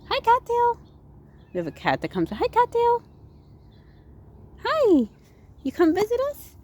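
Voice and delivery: very high, squeaky pet-greeting voice. Real, not acted. The narrator greets the neighbourhood cat in squeaky baby talk.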